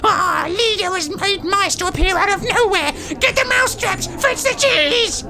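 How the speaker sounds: witches calling out, rising in pitch; excited crowd shouting. A narrator's reading. Witch audience calling for mousetraps and cheese, excited shouting. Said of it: "shouted the audience".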